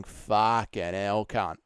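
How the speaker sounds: slow, low Aussie drawl; exaggerated Australian accent, drawn out; Aussie accent demo. Shown as a demonstration. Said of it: "start off every Aussie impression with just saying".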